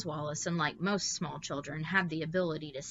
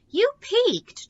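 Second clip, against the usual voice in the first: very high, loud indignant outburst; indignant cry; 12 dB louder than usual. A narrator's reading. Charles protests to the guest about the caviar, high and indignant. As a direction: cry out, offended. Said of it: "Charles cried indignantly".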